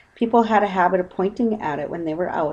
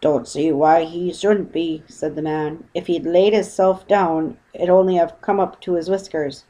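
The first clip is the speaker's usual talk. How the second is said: put-on louder gruff old boatman; boatman's voice; 4 dB louder than usual. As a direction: play the unimpressed boatman.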